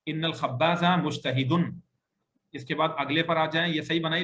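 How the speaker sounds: ordinary talk, nothing marked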